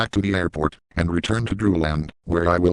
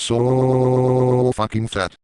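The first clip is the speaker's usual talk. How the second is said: SO stretched very long, higher; drawn-out emphasis on SO; 5 semitones higher, 4 dB louder, much slower than usual. Acted. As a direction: hold the word SO for seconds. Mock emphasis on manager's fatness.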